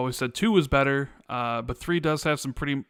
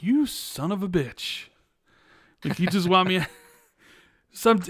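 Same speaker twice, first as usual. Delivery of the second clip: mock indignation; 5 semitones higher than usual. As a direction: draw it out in playful exasperation. Mock reaction to being let down.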